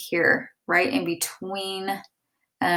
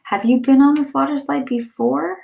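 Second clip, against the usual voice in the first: higher, louder eager question; asking eagerly; 7 semitones higher, 5 dB louder than usual. Shown as a demonstration. Teacher acting out the question to students, eager. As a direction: ask it with real curiosity. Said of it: "I asked".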